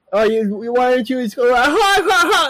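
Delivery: high mocking taunt with laugh; mock him with a jeering laugh. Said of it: "mocking him".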